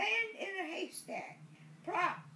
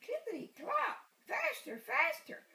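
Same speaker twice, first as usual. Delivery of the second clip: higher, rising excitement chant; playful chant of faster for a child; urging faster; 5 semitones higher than usual. Acted. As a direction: spur the horse on gleefully. Urging horse faster in story.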